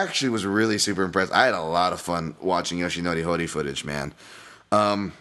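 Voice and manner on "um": ordinary talk, nothing marked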